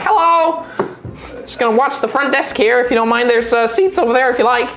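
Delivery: high, polite little gnome voice; young male gnome. Acted. Young gnome minding the front desk, high and polite. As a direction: play him small and courteous. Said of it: "and he's like".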